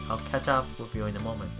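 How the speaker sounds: usual speaking voice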